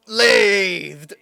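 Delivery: loud silly cartoon call; cartoon exclamation. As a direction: announce the doom like a cartoon. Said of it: "my favorite one was like".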